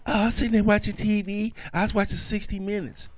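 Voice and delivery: high-pitched, put-on girlfriend voice; girlfriend impression voice.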